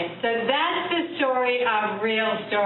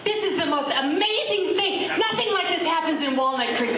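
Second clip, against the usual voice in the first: excited; 8 semitones higher than usual. Retold or imitated. Eager fan gushing about the show.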